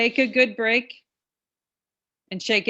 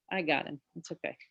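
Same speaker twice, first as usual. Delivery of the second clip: quiet aside; 6 semitones lower, 12 dB quieter, faster than usual. Real, not acted. Quiet aside, handling the dog.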